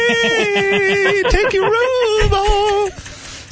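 high, drawn-out falsetto come-on. Pretend. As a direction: stretch it out in a mock high falsetto. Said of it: "Ace picks up on you in his falsetto".

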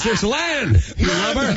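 retold lookout shout; shouting from crow's nest. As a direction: call out land from the mast. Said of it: "and he yells".

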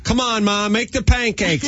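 high-pitched whiny-kid mock yell. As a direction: imitate a kid arguing back at his mom. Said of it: "Ray would start yelling at her".

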